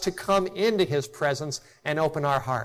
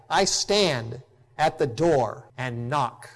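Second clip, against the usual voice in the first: solemn scripture reading. Acted.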